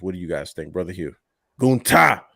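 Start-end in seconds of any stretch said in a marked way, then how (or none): 1.6-2.2 booming, high-pitched shouted name; Klingon bellow. Pretend. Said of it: "say it like a Klingon from Star Trek".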